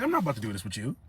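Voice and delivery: quiet words through a laugh; laughing refusal. Retold or imitated. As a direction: shrug him off, laughing softly. Refusing to argue with child.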